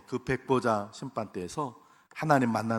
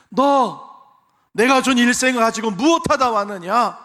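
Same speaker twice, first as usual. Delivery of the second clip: God's question, preached; 10 semitones higher, 11 dB louder than usual. Pretend. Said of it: "질문하실 거예요".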